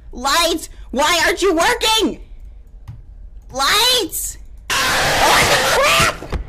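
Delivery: real terror, yelling at the screen; desperate high-pitched yells; panicked screaming. Real, not acted. Screaming at failing light.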